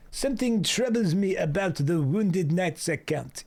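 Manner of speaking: game character in a hammed-up French accent; Alphino, rough French accent. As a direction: put on a rough, generic European-French accent. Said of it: "That's a rough French accent".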